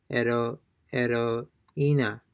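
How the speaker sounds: heroína slowly in parts, ero... EE-na, no H; silent H, broken down. Shown as a demonstration. Teacher breaking the word down for learners, heroína slow with silent H. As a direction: break "heroína" before the stressed I. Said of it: "they're not pronouncing the H".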